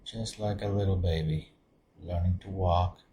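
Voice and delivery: soft, low-key meditation voice with long pauses; guided meditation. Acted. Meditation leader settling listeners into open awareness, guided meditation. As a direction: guide them gently inward, softly, leaving space between phrases.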